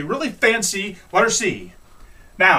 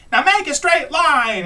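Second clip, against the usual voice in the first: put-on high, slow kids' chant; sing-song it out high like a kid; kids dictating; 11 semitones higher, 5 dB louder, slower than usual. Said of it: "they're going like".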